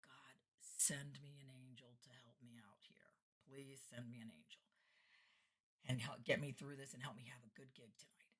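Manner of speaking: low, whispered plea; hushed prayer. Retold or imitated. Nervous entertainer praying for help, low and hushed. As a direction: pray it under your breath.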